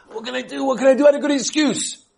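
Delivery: high, pleading mock whine; mock complaint, pitched high; whiny excuse-maker. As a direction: mimic someone hiding behind excuses.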